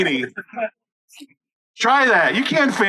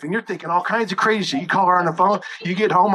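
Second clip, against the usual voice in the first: ordinary talk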